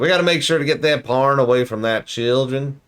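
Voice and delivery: mock Southern moralizer, dragging every word; Southern preacher parody for the children; weird southern accent. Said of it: "this weird southern accent".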